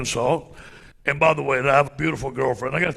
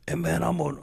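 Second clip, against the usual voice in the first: ordinary talk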